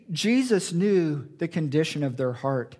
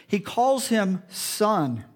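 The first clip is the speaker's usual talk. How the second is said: ordinary delivery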